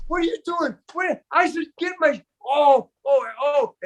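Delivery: high, hammed-up furious squeal; mock outrage. Driver's tight friend shrieking at the toll, mock rage.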